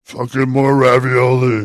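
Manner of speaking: chewing, talking round a bite; speaking with a mouthful; mouth full. Real, not acted. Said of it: "as you take another bite".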